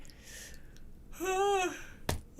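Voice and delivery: long drawn-out yawn; big yawn. Real, not acted. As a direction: yawn openly mid-sentence. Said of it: "where that yawn came from".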